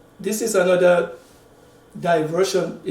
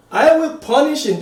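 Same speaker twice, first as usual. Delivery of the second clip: a light voicing of a ruler passing sentence, higher and louder; Solomon; 8 semitones higher, 5 dB louder than usual. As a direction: raise pitch and volume slightly theatrically. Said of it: "Solomon talking".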